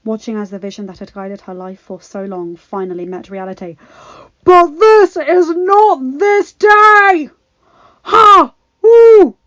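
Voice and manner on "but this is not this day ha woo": much higher, louder, slow battle shout; rallying war cry. Acted. Hero rallying his army before battle, roaring. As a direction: rouse the whole army.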